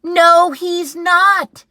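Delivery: high shrill scream. Acted. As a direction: scream it in protest. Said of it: "screamed Eddie".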